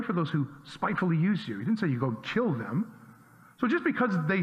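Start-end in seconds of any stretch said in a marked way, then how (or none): none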